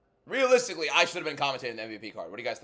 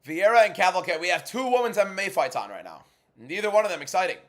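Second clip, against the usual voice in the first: raised, projected streamer voice; loud announcing; 7 semitones higher, 8 dB louder than usual. Real, not acted. Streamer telling viewers about two fights, loud and projected. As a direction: call it out loud and high.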